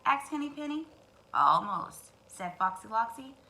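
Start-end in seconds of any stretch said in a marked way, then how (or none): 1.3-2.1 low drawn-out fox; sly fox. Acted. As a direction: reassure with a sly smile. Fox answers.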